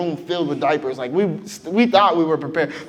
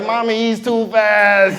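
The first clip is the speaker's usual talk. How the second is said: put-on whiny kid; 7 semitones higher, 5 dB louder, slower than usual.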